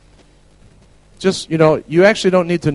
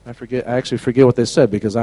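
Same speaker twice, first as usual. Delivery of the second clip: ordinary talk, nothing marked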